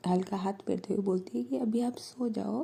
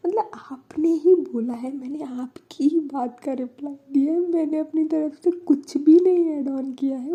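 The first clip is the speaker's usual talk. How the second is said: defensive exasperation; 6 semitones higher, 7 dB louder than usual. Retold or imitated. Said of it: "तो बानी like".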